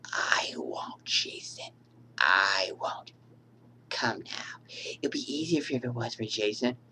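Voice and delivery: eerie whispered reassurance; hushed creepy whisper. Acted. Mother answering Jason's voice.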